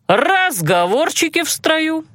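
high, drawn-out drill-sergeant rebuke; barked order. A narrator's reading. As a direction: bark it slowly at cadets whispering in line. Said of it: "рявкнул магистр".

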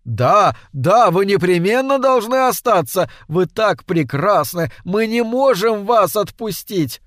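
loud, eager plea; crying out together. A narrator's reading. Guests crying out to Death to stay, loud. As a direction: beg her to stay, all at once. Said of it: "вскричали все в один голос".

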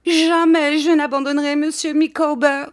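high, loud frantic vow; overwrought, shrieked devotion; hysterical cry. A narrator's reading. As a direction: cry it out while struggling. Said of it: "s'écria mistress Micawber en se débattant".

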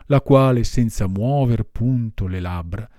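ordinary delivery, nothing marked

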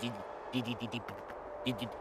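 leise, stockend vor Angst; ängstliches Stammeln. Acted. Angst auf dem Schneebogen. (Begleiter stammelt auf der Schneebrücke, leise und verängstigt)

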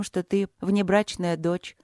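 usual speaking voice

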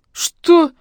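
sharp, very high, loud bark. A narrator's reading. Investigator startled by the woman's question, angry bark. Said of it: "рявкнул Донских".

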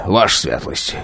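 low, soft bow of a phrase; «ваша» stressed, falling and soft; fawning official. A narrator's reading. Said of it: "до чего ласково разговаривал". (заискивающе «ваша светлость»)

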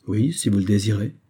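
ordinary delivery

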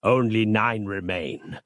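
slow, understated glum report; mildly downcast and slow. A narrator's reading. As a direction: conclude it gloomily, drawing the words out. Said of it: "he concluded glumly".

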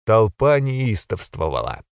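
ordinary delivery, nothing marked

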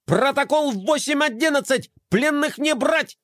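high, emphatic little robot; robot commander. A narrator's reading. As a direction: bark orders, high-pitched. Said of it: "заверещал Мелкотрон".

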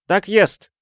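high, loud, proud student. A narrator's reading. Student confirms his noble father to the detective, proud and high. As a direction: announce it proudly, chin up. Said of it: "гордо вскинул подбородок поэт".

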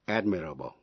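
AD-mirable, first syllable stressed; teaching AD-mirable. Shown as a demonstration. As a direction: admirable with the weight on AD. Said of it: "nhấn mạnh vần đầu".

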